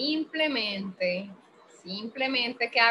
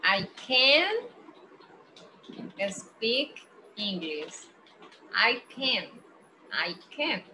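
slow, "can" without a T; slow model sentence; much slower than usual. Shown as a demonstration. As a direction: read it slowly, "can" short and plain.

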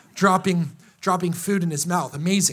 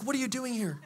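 softer, hushed question from God; God's gentle voice; 3 semitones higher, 9 dB quieter than usual. Retold or imitated. God gently questions the sulker, soft and hushed. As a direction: ask it softly, as God calling in the cave. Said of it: "God's like".

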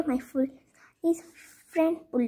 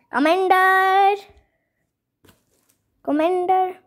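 calling out; 6 semitones higher, 6 dB louder than usual. Acted. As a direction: hail the commander from a distance. Calling out for the commander.

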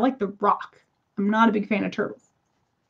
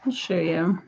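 usual speaking voice

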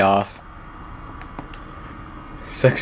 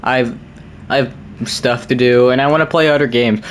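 ordinary delivery

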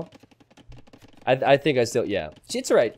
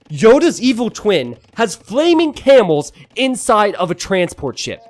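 amazed exclamation; 6 semitones higher, 6 dB louder than usual. Real, not acted. Astonished at game tactic.

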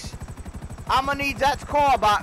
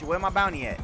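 normal talking voice

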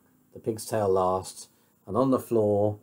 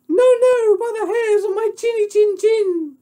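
very high, loud piggy squeak; little pig voice; 10 dB louder than usual. Acted. Little pig refuses the wolf, high defiant squeal. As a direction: squeal the refusal in a tiny pig's falsetto.

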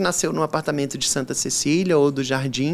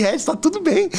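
much higher-pitched mock plea; retold exasperated reassurance; 10 semitones higher than usual. Guest to host worried about missing flowers, mock exasperated. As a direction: play at insisting all is fine.

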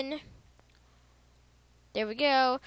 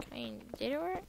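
whisper; 4 semitones higher, 10 dB quieter than usual. Real, not acted. Quietly checking if it works.

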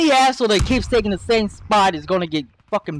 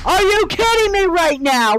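exasperated outburst; 8 semitones higher than usual. Real, not acted. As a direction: throw it at the screen in disbelief. Repeated outburst, then yelled.

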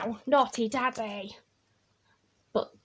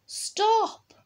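high-pitched storybook cry of stop; urgent stop; 9 semitones higher, 4 dB louder than usual. A narrator's reading. Child to runaway Bear, high urgent call. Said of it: "I said".

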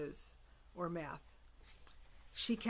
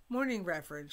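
curt, firm greeting; clipped and cold, a touch loud; stern; 8 dB louder than usual. A narrator's reading. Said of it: "she said sternly".

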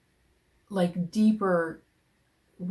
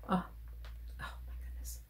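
soft hushed wonder; awed whisper; 11 dB quieter, much faster than usual. Real, not acted. Unboxing Jude's gift, whispered awe. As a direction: breathe it out quietly.

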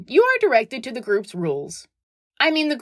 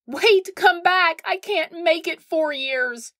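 mock desperate plea; 7 semitones higher, slower than usual. Real, not acted. Pleading after skater leaves.

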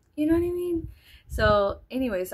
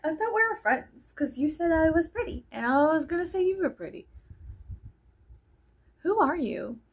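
higher, wounded rising complaint; mock hurt complaint; 8 semitones higher than usual. Vlogger retells confronting a rude girl, higher hurt complaint. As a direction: sound betrayed and baffled. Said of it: "I was like".

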